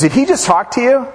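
lightly incredulous retold question; curious questioning. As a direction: play the friend asking, voice lifted. Friend questions the girl in story.

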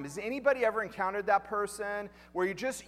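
ordinary delivery

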